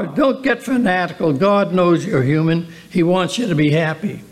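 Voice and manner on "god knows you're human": ordinary talk, nothing marked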